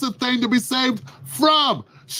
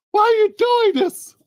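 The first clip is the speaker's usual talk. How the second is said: terrified plea; 13 semitones higher than usual. Acted. Bound victim pleading with the invaders, high. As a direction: cry out the question in fear. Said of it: "You're sitting there".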